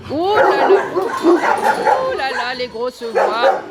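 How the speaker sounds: slow, stretched teasing of the barking dogs; drawn-out coo of mock reproach; mock scolding. Real, not acted. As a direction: playfully chide the loud dogs.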